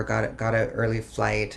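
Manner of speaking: ordinary delivery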